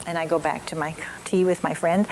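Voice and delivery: ordinary talk, nothing marked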